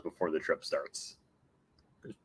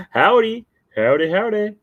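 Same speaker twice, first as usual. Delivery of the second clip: loud, high cowboy greeting; much higher, louder yeehaw greeting; cowboy howdy; 10 semitones higher, 7 dB louder than usual. Acted. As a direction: holler it like a cowboy.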